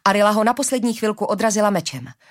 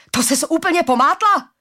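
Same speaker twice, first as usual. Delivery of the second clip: loud, high outraged yell; angry shout; 8 semitones higher, 5 dB louder than usual. A narrator's reading. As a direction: yell at her in disbelief. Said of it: "křičel na ní Suf".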